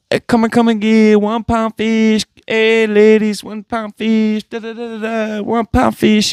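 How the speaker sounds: mock street-vendor sales chant; imitating the one pound fish seller. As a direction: hawk fish to passing ladies like the one pound fish guy. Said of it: "the one pound fish guy".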